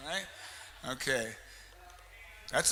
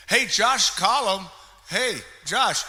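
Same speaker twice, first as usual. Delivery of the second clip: 1 calling out; 7 semitones higher, 8 dB louder, slower than usual. Real, not acted. Emcee calls out prize winner.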